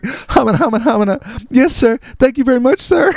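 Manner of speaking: high, squeaky mock panic; stammer, then squeak out thanks; terrified babbling. Terrified kid thanking star for job, hammed-up panic.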